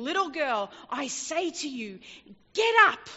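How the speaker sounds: rising to a loud, high command on get up; Jesus calling the dead girl to get up, loud and commanding. Acted. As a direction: call the girl to rise.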